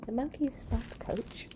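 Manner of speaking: soft whisper to the listener; whispered aside. Real, not acted. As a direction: let it slip out under your breath.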